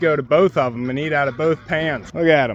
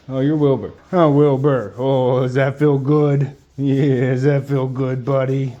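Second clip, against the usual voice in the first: tender pet talk; 4 semitones lower than usual. Real, not acted. Scratching the pig.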